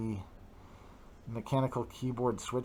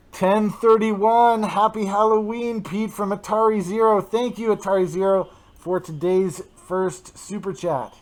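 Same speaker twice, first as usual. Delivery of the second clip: higher and louder, hyped; excited shoutout; 7 semitones higher, 8 dB louder than usual. Real, not acted. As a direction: hype up the donor. Excited super chat callout.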